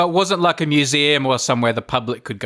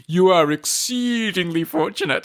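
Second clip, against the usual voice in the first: slow mimicked catchphrase, EXCEEDINGLY drawn out; mock pronouncement; 3 semitones higher, slower than usual. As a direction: quote it grandly, stretching EXCEEDINGLY. Couple's catchphrase copying the guide.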